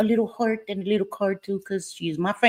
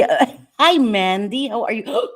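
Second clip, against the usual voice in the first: ordinary talk, nothing marked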